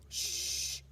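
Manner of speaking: whispered shh; quiet hiss; hissed shush. Acted. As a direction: hush the others urgently. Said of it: "hissed Pip".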